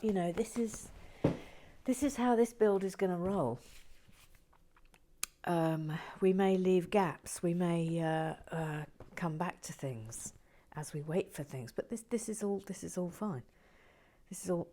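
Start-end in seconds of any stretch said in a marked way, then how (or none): none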